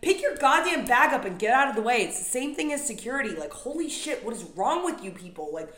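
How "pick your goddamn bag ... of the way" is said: much louder, higher barked order; imitated angry yell. Telling off travelers at the carousel, loud angry order. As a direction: yell it at the slowpoke. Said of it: "I'm like".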